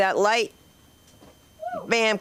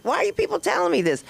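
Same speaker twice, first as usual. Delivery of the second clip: high, fast incredulous question; exasperated protest; 10 semitones higher, faster than usual. Acted. Comic retorting to people sharing gossip, exasperated. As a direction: rush it out high, mock baffled.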